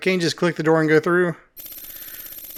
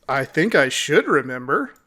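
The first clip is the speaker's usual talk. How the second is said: ordinary delivery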